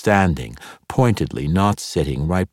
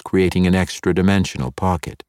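ordinary delivery

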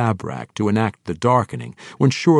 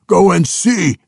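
roared order, much higher and louder; 11 semitones higher, 10 dB louder than usual. A narrator's reading. Orc warlord to his guards, roaring. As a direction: bellow at the guards. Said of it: "he roared".

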